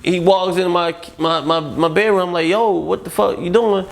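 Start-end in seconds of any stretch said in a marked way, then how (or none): 2.5-3.9 alarmed confrontation. Retold or imitated. Confronting Ja in bedroom.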